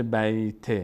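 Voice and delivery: بیت with an e added, "bayte"; faulty "bay-te" for بیت; wrong voweled t. Shown as a demonstration. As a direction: tack a short vowel onto the t of بیت. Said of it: "نشه".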